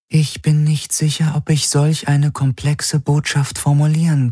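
high, even-pitched Helix voice; Helix, an artifact. A narrator's reading. The Helix answers Galen, high and level.

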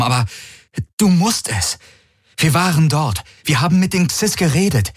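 lightly voiced desperate plea; pleading envoy. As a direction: beg her to call the ships back. Garlin pleads with Ylana.

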